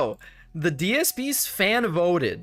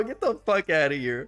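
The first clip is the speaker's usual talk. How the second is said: ordinary delivery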